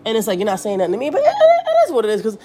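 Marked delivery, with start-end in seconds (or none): 1.1-2.4 laughing it off. Real, not acted.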